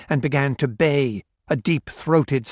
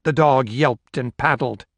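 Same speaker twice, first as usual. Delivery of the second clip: plain reading voice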